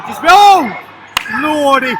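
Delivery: high, loud yelp then exclamation; excited outburst. Real, not acted. Commentator seeing a save, excited outburst. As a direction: blurt it at a near goal. Reacting to a near goal.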